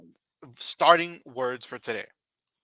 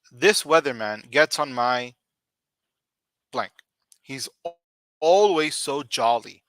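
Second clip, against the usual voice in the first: louder grumble about "jolly"; annoyed complaint; 5 dB louder than usual. Shown as a demonstration. Teacher reads an example about an annoying weatherman, grumbling. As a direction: gripe about the cheerful weatherman. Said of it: "example sentence read".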